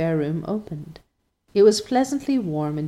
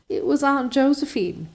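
gasping with laughter; 7 semitones higher than usual. A narrator's reading. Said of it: "gasping with laughter".

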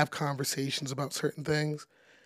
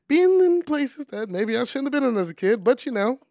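laughing it off, pitched up and loud; 8 semitones higher, 7 dB louder than usual. Real, not acted. Laughing at his wild childhood.

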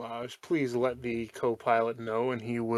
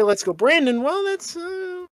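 mock innocent voice; 5 dB louder than usual. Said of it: "When you say".

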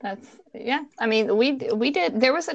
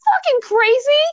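very high-pitched, aghast disbelief; retold incredulous outburst; 3 dB louder than usual. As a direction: replay being appalled at a friend.

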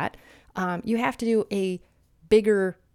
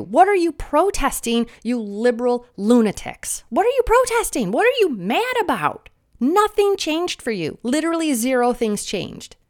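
exasperated rant; 3 semitones higher, 4 dB louder than usual. Acted. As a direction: hurl it at the protesters in disbelief. Ranting at protesters.

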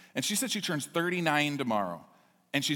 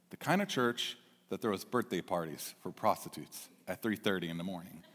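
much lower, softer, solemn; quiet weighty answer; 7 semitones lower, 7 dB quieter than usual. Retold or imitated. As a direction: pastor answering the owner, hushed. Pastor's quiet weighty reply.

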